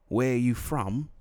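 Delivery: put-on slow question in English accent. English stranger questioning the faker, slow English accent. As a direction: imitate an Englishman, drawing it out. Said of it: "He was English".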